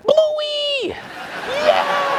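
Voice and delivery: very high delighted squeal; a little boy thrilled to get his toothpaste back; squeaky kid cheer; excited kid. Retold or imitated. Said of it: "super excited".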